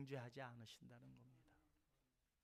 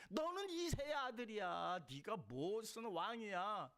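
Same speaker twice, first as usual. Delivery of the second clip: scornful taunt; 8 semitones higher, 4 dB louder, slower than usual. Retold or imitated. Voicing people who scorn David.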